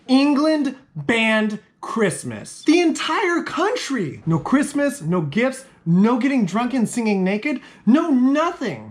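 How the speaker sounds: high, incredulous rant; hammed-up indignation, hitting each item high; mock outrage. Real, not acted. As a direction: pitch it up in comic disbelief at the ban.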